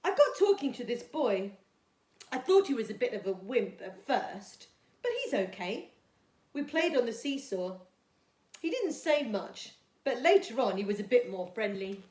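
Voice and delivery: put-on storytime reading for young children; gentle storybook read-aloud for Year two; reading a story. A narrator's reading.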